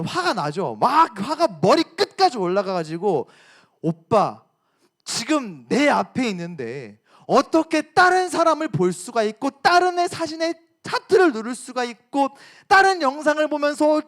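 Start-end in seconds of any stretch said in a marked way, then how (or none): 3.8-14.0 acted indignant complaint, pitched up as it builds; angry girlfriend. Girlfriend confronting boyfriend over liked photos, angry. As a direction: play a girlfriend telling her boyfriend off. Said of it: "막 화를 냈더니".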